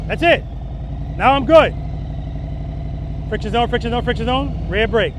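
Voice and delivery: loud, high, called over the bike; shouting over engine. Real, not acted. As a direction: call it out over the engine noise. Demo ride, talking over engine.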